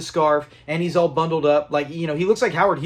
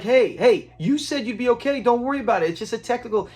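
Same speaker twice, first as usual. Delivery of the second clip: higher-pitched acted protest; raised pitch, playfully insistent; mock pleading reassurance; 6 semitones higher than usual. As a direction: imitate someone frantically talking a person down. Acts out Fred as roles flip.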